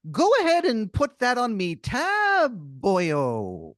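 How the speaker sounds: high, loud, slow stage-Irish brogue. Acted. Leprechaun-like stranger greeting the player, hammy Irish brogue. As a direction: ham up a leprechaun-style Irish barfly.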